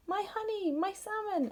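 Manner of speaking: high, wailing bear voice; lightly voiced upset bear; Bear's dismay. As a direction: cry out the lost treasures high.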